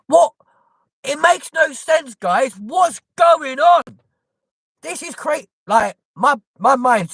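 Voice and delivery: high, slow, shouted disbelief; exasperated shout. Real, not acted. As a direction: throw the words out in disbelief. Exasperated outburst.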